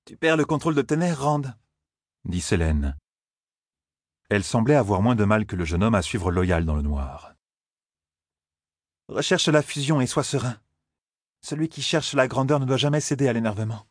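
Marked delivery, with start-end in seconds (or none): 0.1-1.4 high, cool feminine voice; reproving young woman. Acted. Selene telling Rand off on the run, reproving. 9.1-13.9 high, composed feminine character voice; lecturing young woman. Acted. Selene counselling Rand to stay serene, lecturing.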